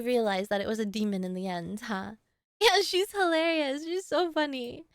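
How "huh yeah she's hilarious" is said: usual speaking voice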